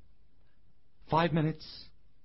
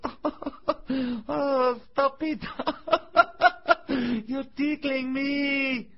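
high squeaky giggles bursting through; tiny giggling voice; 13 semitones higher, 5 dB louder, much slower than usual. A narrator's reading. Piece of wood tickled by the plane, high helpless giggling. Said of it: "This time it giggled".

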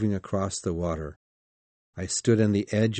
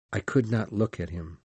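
usual speaking voice

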